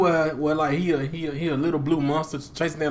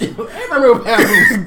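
high, fast laughing reply; 8 semitones higher, 9 dB louder, faster than usual. Real, not acted. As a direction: laugh it out quickly. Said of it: "laughing reply".

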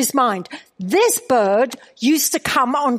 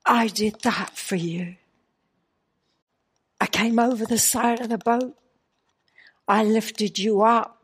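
softer, tender, reverent voice of God; Holy Spirit's gentle voice; 4 semitones lower, 5 dB quieter than usual. Retold or imitated. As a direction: speak softly like a loving divine whisper. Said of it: "the Holy Spirit said to me".

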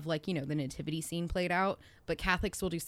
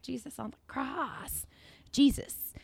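imitating a toddler; 7 semitones higher, 5 dB louder than usual. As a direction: act out a little kid's eerie announcement. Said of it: "And he's just like".

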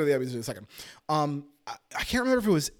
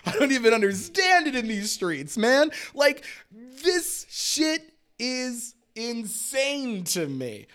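incredulous rant; 7 semitones higher, slower than usual. Real, not acted. Keeps ranting about Emmy snubs.

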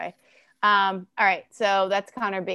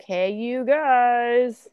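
slow, stretched "Hey, you guys" in a thick character voice; doing the Goonies line; Goonies quote; 2 semitones higher, much slower than usual. Retold or imitated. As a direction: drag it out like Sloth. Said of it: "What movie is that from?".

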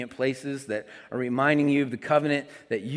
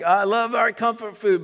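high, loud comfort-food lover's plea; mock protest; 8 semitones higher, 7 dB louder than usual. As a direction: protest loudly, pitched way up.

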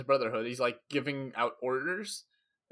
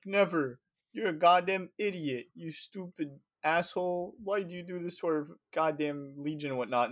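angry retort; 4 semitones higher than usual. Retold or imitated. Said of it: "and Shaban's like".

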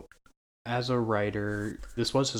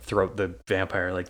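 usual speaking voice

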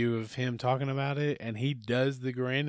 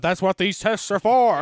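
crash dummy voice; 8 semitones higher, 10 dB louder than usual. Retold or imitated.

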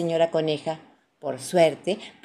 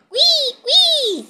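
very high, loud little squeaks; piercing tiny-animal squeal; 10 dB louder than usual. A narrator's reading. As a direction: squeal like an excited baby rabbit. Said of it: "chilla Richard".

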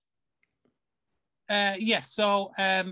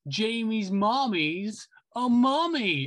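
put-on taunting child; 6 semitones higher, 4 dB louder, much slower than usual. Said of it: "kids in this who are going like".

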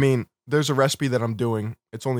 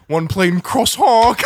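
slow, drawn-out, louder mock-serious read; deadpan slow order repeat; 6 semitones higher, 7 dB louder, much slower than usual. Imitating the Starbucks guy teasing with a slow solemn repeat. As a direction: drag each word out to troll him. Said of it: "the guy was like".

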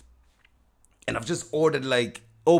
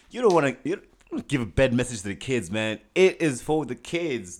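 mock preachy; 5 semitones higher, 5 dB louder than usual. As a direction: preach it like a fake sage. Mock wise voice about kids.

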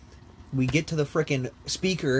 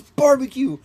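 loud, high mock blurt; high-pitched, hammed-up outburst; blurted answer; 11 semitones higher, 8 dB louder, slower than usual. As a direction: imitate a sleeper blurting out an order. Half-asleep friend blurts his answer.

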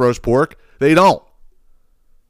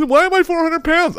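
very high, put-on mocking voice; mock whiny lament. Podcaster mocking an overweight food reviewer, high-pitched mock lament. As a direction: squeak it out in mockery.

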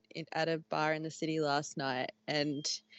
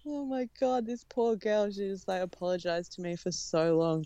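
ordinary talk, nothing marked

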